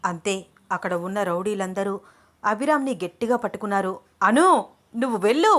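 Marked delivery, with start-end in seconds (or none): 4.2-5.5 raised, higher-pitched urgent command; narrator's brief commanding shout; shouted order. A narrator's reading. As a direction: call it out loudly like an order. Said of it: "గట్టిగా అరుస్తూ".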